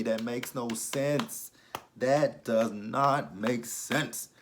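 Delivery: "makes no sense", slow and drawn out; slow, weighty stress on "not make sense"; exasperated emphasis. Real, not acted. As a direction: spell out "does not make sense" word by word. Exasperated by implausible plot.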